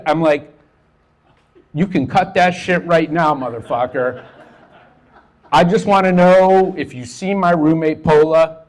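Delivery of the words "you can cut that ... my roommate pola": louder, higher, fed-up demand; exasperated outburst. Retold or imitated. Fed-up visitor snapping at a costumed juggler, loud. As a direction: cut through his act, irritated.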